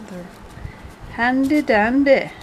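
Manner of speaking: put-on a playful burst of Scottish accent on handy dandy. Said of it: "That's why I break out in Scottish accent".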